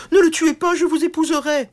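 very high, loud pleading; desperate plea. A narrator's reading. Princess begging the knight to spare him, desperate. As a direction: beg for his life. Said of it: "cria la princesse".

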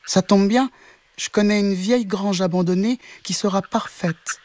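high, piping kitten; small kitten voice. Acted. Kitten offers the cow a barn, high voice. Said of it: "dit le chat".

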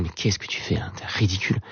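hushed, scolding inner voice; voice of conscience. Retold or imitated. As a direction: whisper it like a nagging conscience. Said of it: "se prend souvent lui-même par le col en disant".

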